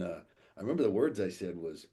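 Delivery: ordinary delivery, nothing marked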